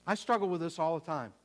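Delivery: normal talking voice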